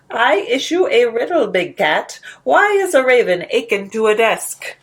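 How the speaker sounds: mischievous Hatter challenging the lion; Hatter's riddle. Acted. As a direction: tease the big cat with the riddle. Hatter poses his riddle.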